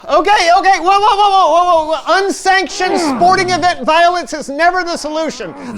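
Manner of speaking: loud urgent calming; alarmed shouting. Acted. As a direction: yell to stop them. Stopping a fight.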